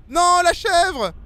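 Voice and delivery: high, loud cry of dismay; dismayed outcry. Real, not acted. Startled at the goat in the game, dismayed outcry. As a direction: cry out, drawing out the words.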